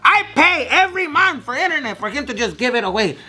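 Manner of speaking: high-pitched indignant dad rant; imitated angry yelling. Dad scolding son for giving away internet, imitated yelling. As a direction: act out a dad yelling about the bill. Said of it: "from yelling at me over and over".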